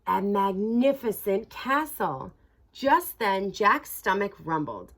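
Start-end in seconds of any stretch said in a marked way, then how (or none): none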